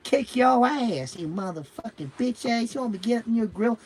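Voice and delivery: high-pitched, drawn-out mock trash talk; lipping everybody off. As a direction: imitate an angry passenger mouthing off, pitch way up. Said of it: "she's lipping everybody off".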